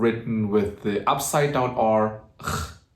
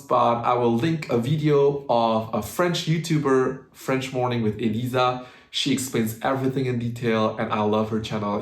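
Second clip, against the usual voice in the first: normal talking voice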